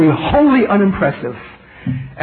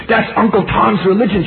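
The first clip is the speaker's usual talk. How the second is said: a much higher, bristling retort; 9 semitones higher than usual. Retold or imitated. Student bristling at the preacher's question. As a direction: snap it back, offended. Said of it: "did she bristle".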